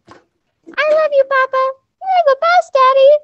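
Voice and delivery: put-on sweet little boys chirping; little kid voice. Father's little boys speak.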